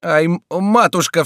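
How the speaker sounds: high, loud stammered yelp; frightened cry. A narrator's reading. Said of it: "вскричал Фриар".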